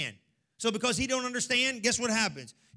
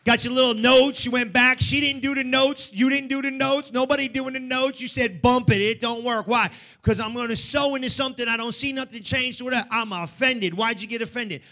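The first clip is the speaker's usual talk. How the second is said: loud, full-force sermon delivery; fired-up preaching; 4 semitones higher, 12 dB louder than usual. Real, not acted. Preacher demanding praise from the congregation, shouted preaching. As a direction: drive it hard to rouse the house.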